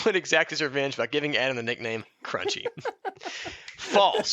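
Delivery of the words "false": laughing as he cuts in to deny the bio; laughing denial. Real, not acted. Cutting in on the reading to deny it, laughing.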